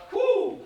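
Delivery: high, loud whoop; whoo cheer. Real, not acted. Pumping himself up before a show, a high whoop. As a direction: let out a joyful whoop.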